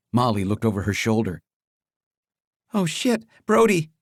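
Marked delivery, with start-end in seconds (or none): 2.7-3.9 panicked. Acted. Fleeing mobsters in a car.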